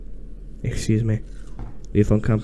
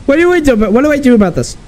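high, loud and frantic; panicked yelling; 12 semitones higher, 5 dB louder, much faster than usual. Real, not acted. Player asks teammates what to do as attack comes, panicked. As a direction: cry out in alarm.

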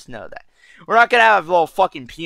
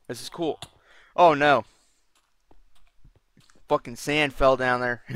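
ordinary talk

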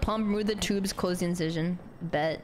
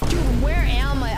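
louder, exasperated call; loud frustrated shout; 9 dB louder than usual. Real, not acted. Lost in dark game level.

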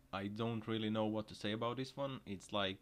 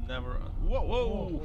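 loud rising whoa, much higher; startled; 8 semitones higher, 9 dB louder than usual. Real, not acted. Vlogger watching a crash happen, loud shocked whoa. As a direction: gasp it out in shock.

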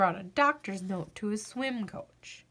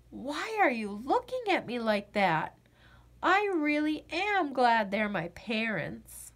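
plain reading voice

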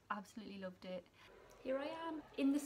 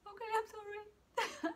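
much higher, slow, small squeaky sorry; timid apology; 11 semitones higher than usual. Retold or imitated. Student sheepishly apologising to her nervous mentor, high and small. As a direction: shrink into a tiny sheepish voice. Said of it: "I was like".

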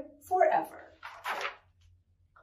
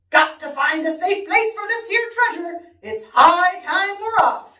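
raised, higher captain's announcement; announcing; 6 semitones higher, 5 dB louder than usual. Acted. Pirate captain announces departure to crew, loud proclamation. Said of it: "he announced".

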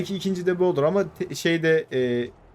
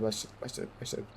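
whisper; 5 semitones lower, 14 dB quieter than usual. Real, not acted. Whispered countdown cheer.

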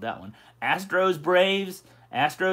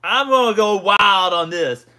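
excited; 4 semitones higher, 8 dB louder, slower than usual. Real, not acted. Hyping the stream predictions.

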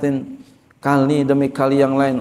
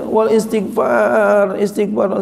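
usual speaking voice

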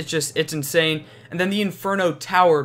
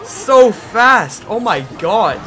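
excited laughing; 8 dB louder, much slower than usual. Real, not acted. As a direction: laugh out in delight. Laughs at quick tower kill.

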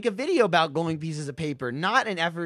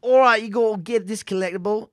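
raised, put-on English accent; 4 semitones higher, 5 dB louder than usual. Co-host doing the collectible on request, English accent. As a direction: start in a mock English accent. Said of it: "do this collectible in an English accent".

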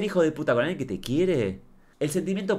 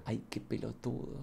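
softer unimpressed sigh; 14 dB quieter than usual. Shown as a demonstration. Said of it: "Yo lo miraría y le diría con esta cara".

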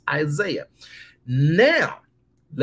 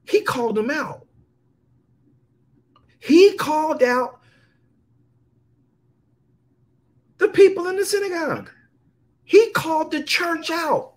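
rising, louder preacher exclaim; excited exclaim; 11 semitones higher, 3 dB louder than usual. Real, not acted. Preacher stirs the congregation, high and loud. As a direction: marvel at how he called them out.

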